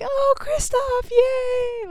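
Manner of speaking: high, slow squeal of joy; delighted cheer. Pretend. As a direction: squeal his name like a fan. Cheering the rescuer.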